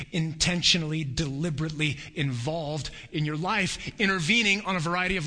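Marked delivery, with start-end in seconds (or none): none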